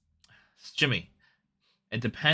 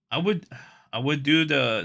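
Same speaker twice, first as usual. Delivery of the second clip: excited; 6 semitones higher, 8 dB louder than usual. Real, not acted. Excited about the idea.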